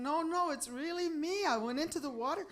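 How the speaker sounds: high-pitched insistent plea; pleading protest. Acted. Husband explaining himself before the guru, pleading. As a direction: plead to be believed, without shouting.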